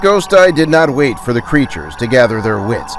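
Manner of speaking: plain reading voice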